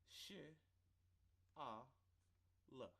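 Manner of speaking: Sh, ah, l sounded out slowly, one sound at a time; sounding out letters. Shown as a demonstration. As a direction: pull apart Sh, ah, l into separate sounds. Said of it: "I'll even sound a few out".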